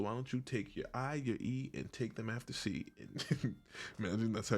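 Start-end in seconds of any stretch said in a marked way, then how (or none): none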